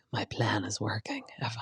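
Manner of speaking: whispered, plotting; scheming whisper. Retold or imitated. Kristen sipping her drink, whispering her scheme. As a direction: hiss it like a villain's plan. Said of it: "she's like".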